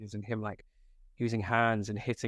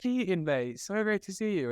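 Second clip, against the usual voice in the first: excited greeting; 8 semitones higher than usual. Retold or imitated. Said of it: "I was like".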